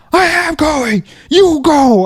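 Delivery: very high, loud mock yell; retold yelling. Jet skier snapping back at the worker, imitated yelling. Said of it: "expecting him to yell at me".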